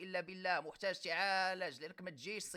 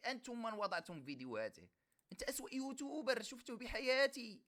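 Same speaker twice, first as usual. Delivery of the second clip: normal talking voice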